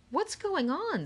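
Haley startled as a secret panel opens; startled question. A narrator's reading. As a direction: blurt it out in alarm. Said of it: "said Haley".

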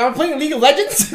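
high, fast, laughing question; laughing quip. Retold or imitated. Friend mocks recruiter's platinum level, laughing. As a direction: laugh through the joke.

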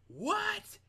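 high, very loud shout; one explosive yell of disbelief; drill sergeant. Retold or imitated. Drill sergeant suddenly going off at recruits, shouted. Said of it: "one of our drill sergeants go".

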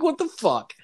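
high, incredulous cry; shocked squeal. Retold or imitated. Retelling his shock at the friend's concrete cow story.

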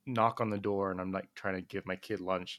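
ordinary talk

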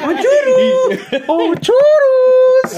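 very high, loud, drawn-out squeal; falsete cômico. Pretend. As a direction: stretch the last vowel in a piercing mock falsetto. Piada com grito agudo sobre Disney.

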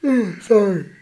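a real yawn sliding into the word. Real, not acted. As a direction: yawn through the apology. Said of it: "Sorry for the yawning".

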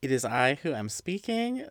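ordinary talk

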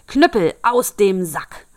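loud, slow shouted command. Acted. Turner yells at the innkeeper, slow loud shout. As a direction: bellow the magic words at the thief. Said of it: "rief er".

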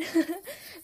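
short high awkward laugh; an embarrassed little laugh. Retold or imitated. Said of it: "Like, awkward laughing".

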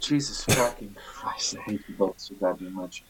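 hissed under the breath; exasperated whisper. Real, not acted. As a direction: whisper the frustration through clenched teeth. Exasperated at the two others.

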